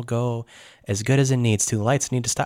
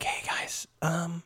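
soft, hesitant half-whisper; hushed, halting approach; 4 semitones higher, 9 dB quieter than usual. Acted. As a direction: whisper a tentative greeting. Character joins the whispered eavesdropping.

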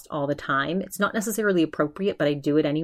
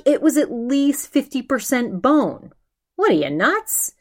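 imitating an outraged rant; mock indignant, pitch up; 8 semitones higher, 3 dB louder than usual. Ben ranting about the bony steak, mock outrage. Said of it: "he's outraged at the price".

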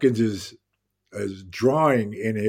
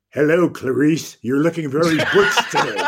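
Hopkins impression; 5 semitones higher, 5 dB louder than usual. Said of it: "And he finally goes".

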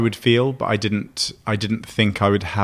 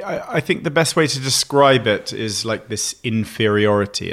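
ordinary talk, nothing marked

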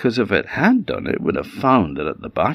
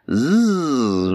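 short, higher voiced buzz; bumblebee buzz; 6 semitones higher than usual. Bee greets the worried vixen, buzzing. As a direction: buzz before speaking, like a bee. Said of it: "And the bee says".

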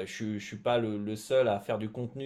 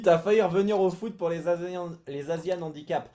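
teasing mock voice; 6 semitones higher, 5 dB louder than usual. Teasing quote of co-host.

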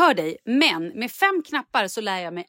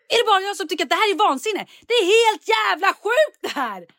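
exasperated outburst; 11 semitones higher than usual. Retold or imitated. Retold outburst over light switches.

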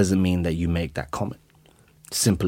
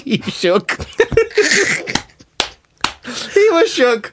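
laughing; slower than usual. Real, not acted. Laughing at scared friend.

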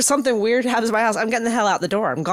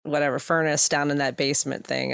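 usual speaking voice

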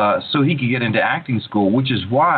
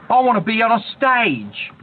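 put-on a young Cushing in a rough, unsophisticated English accent, pitched much higher; 12 semitones higher than usual. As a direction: ham up his old unpolished English accent, high and eager. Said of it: "I said".

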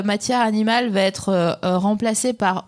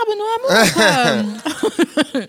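laughing; 4 semitones higher, 3 dB louder, much slower than usual. Real, not acted. Joking about mistaken brother.

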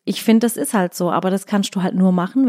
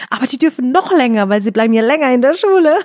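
put-on eager little girl; 4 semitones higher, 5 dB louder than usual. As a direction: play the child who loves school. Mother voices daughter.

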